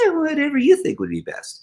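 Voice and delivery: very high, dismissive throwaway; hammed-up non-committal answer; mock indifference. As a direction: shrug it off in a put-on high voice. Mocks a non-committal husband's answer.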